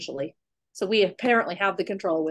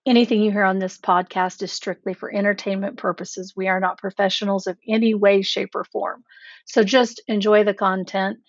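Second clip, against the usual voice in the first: louder announcer-style disclaimer read; 7 dB louder than usual. Acted. Reads the podcast disclaimer.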